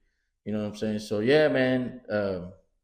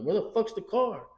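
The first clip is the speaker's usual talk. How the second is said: much higher alarmed question; high-pitched disbelief; 10 semitones higher than usual. Retold or imitated. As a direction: voice jumping up in alarm. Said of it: "I'm like".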